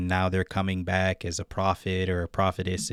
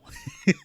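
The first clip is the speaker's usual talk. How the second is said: short laugh. Real, not acted. Laughs at own hell quote.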